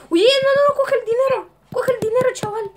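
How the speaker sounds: high, loud urgent yelling; frantic, pleading shouts; panicked shouting. Real, not acted. Panicked yelling during game.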